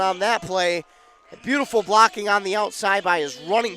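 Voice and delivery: excited play call. Real, not acted. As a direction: call the big gain with building excitement. Touchdown run excitement, big play call.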